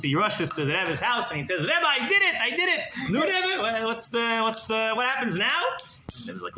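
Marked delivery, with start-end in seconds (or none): 1.6-2.8 high, breathless-with-news exclamation; excited. Retold or imitated. Congregant rushing to report to the Rebbe, excited. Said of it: "He's very excited". 3.1-5.6 high, eager stammer; excited. Retold or imitated. Congregant pressing the Rebbe for results, excited. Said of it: "He's very excited".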